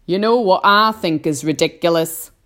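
loud, grinning taunt; cheeky centipede. A narrator's reading. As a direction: throw out the question with a loud grin. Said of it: "the centipede said, grinning".

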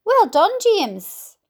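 loud, high, slow congratulation; shouted praise. A narrator's reading. Grasshopper congratulating James on the catch, shouting. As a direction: call out hearty praise, stretching the words. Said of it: "shouted the old green grasshopper".

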